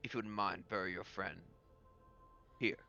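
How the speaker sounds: very halting, grave speech with long pauses; solemn lord character. Acted. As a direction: honor the dead, a few words at a time. Game master voices the lord.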